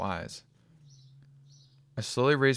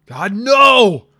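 much higher, very loud anguished scream; anguished cry; 13 semitones higher, 18 dB louder than usual. A narrator's reading. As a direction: cry out at the burning house. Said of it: "I exclaimed".

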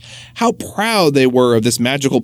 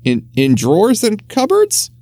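surprised; 7 semitones higher than usual. Acted. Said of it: "said Winston with surprise".

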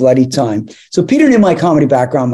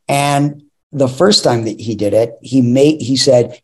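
usual speaking voice

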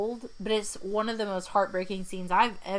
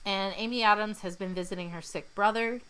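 usual speaking voice

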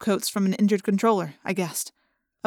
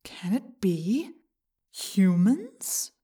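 whispery telepathic voice; 5 dB quieter, much slower than usual. A narrator's reading. Yeerk voice in Jake's head.

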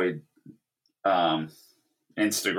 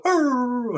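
dog whine imitation; 3 dB louder than usual. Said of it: "And he'd go".

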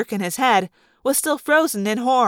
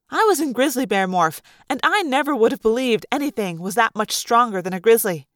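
ordinary delivery

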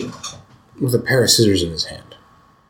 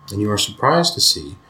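ordinary talk

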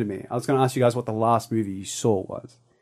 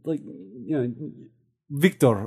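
put-on Eastern European accent; 2 semitones higher, 6 dB quieter, slower than usual. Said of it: "Eastern European".

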